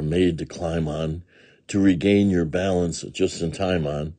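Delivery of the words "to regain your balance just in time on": ordinary delivery, nothing marked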